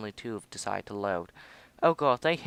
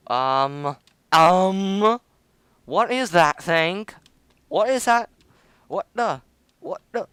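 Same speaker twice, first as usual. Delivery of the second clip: startled; 7 semitones higher, 9 dB louder, slower than usual. Real, not acted. Unknown ship appears suddenly.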